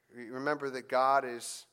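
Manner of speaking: ordinary talk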